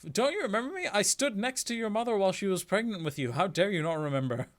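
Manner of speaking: a lightly voiced scolding relative; offended relative. Relative scolding him for forgetting her, mildly indignant.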